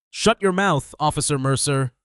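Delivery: loud furious bark. Acted. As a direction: bark it at full volume. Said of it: "Beckett barked".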